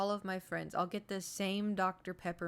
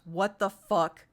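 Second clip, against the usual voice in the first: all caps; 9 dB louder, slower than usual. Retold or imitated. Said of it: "he goes, all caps".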